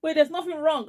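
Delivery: high-pitched angry protest; retold anger. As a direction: push back crossly, acting out the quarrel. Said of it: "I was getting angry".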